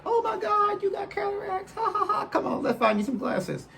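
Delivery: very high, squealing imitation of tickled shop workers; mocking amused staff. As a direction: mimic them cackling at you in falsetto. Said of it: "just thought it was so hilarious".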